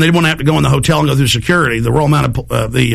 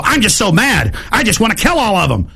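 mock angry fed; 6 semitones higher than usual. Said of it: "And they turn and go".

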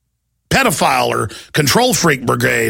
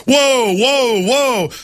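high, put-on thug voice calling whoa; mock guard; 8 semitones higher, much slower than usual. Host mocking airport agents, high put-on whoa. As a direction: ham up a startled TSA agent. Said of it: "And they start going".

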